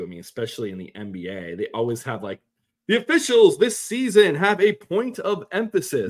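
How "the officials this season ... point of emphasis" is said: loud, high sportscaster announcement; Kevin Harlan. Acted. Podcaster imitating an announcer on officials' point of emphasis, sportscaster voice. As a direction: call it out like a play-by-play broadcaster. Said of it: "Kevin Harlan voice".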